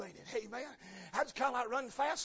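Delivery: normal talking voice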